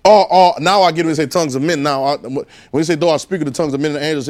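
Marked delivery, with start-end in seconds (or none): 2.9-4.3 quick, offhand recitation of the verse; just repeating words without meaning; rattled off. Shown as a demonstration. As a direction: rush through it like rote. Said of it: "I repeat what they said".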